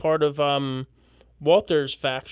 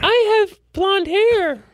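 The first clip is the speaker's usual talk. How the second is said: put-on high girly voice; 5 dB louder, much slower than usual. Voicing a character in parody trailer.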